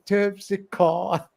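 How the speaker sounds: Terpsichore slowly drawn out with a long final "core"; mock New Jersey Terpsichore. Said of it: "in his New Jersey accent".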